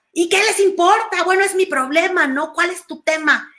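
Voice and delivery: mock irritable daughter-talk back; irritated retort. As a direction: act out a touchy, defensive reply. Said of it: "La emoción reactiva".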